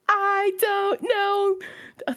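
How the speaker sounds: very slow, high, stretched words; drawn-out whine. Retold or imitated. Answering her sister for the umpteenth time, very slow and whining. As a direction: stretch every word out helplessly.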